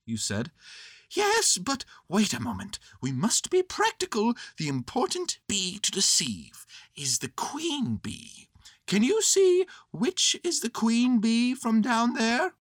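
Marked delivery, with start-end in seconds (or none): 1.1-12.5 high, deliberate bear voice; high-voiced bear being practical; Winnie the Pooh. Bear instructing boy below, high deliberate voice.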